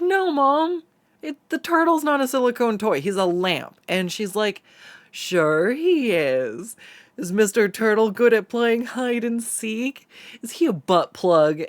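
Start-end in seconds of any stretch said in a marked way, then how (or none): 0.0-3.8 much higher whiny "No, Mom"; exasperated protest. Retold or imitated. Protesting mom's teasing. 5.1-11.6 sly teasing mom. Retold or imitated.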